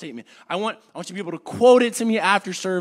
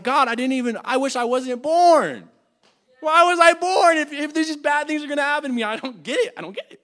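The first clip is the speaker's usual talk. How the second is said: raised, despairing complaint; Job's lament; 5 semitones higher, 5 dB louder than usual. Retold or imitated. Preacher voicing Job cursing his life, raised despairing lament. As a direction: rail at God, then fade off. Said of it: "He's like".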